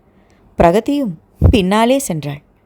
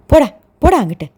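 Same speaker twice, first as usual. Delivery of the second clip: louder, higher get lost; shooing away; 5 semitones higher, 4 dB louder, much faster than usual. Acted. Sister chasing her brother away, loud shoo. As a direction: chase your little brother off. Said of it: "தம்பியை விரட்டினாள்".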